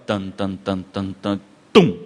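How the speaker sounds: low mock horror-movie build-up of beats; horror-film music. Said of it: "Quando dá aqueles negócios". (imitando trilha de filme de terror, grave e baixinho)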